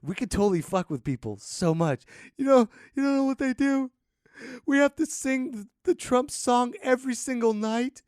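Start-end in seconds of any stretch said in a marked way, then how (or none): 2.4-8.0 high, louder, whimpering complaint; mock desperate plea. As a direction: play the traumatized refugee, hammed up. Pretend refugee pleading again.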